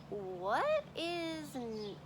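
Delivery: normal talking voice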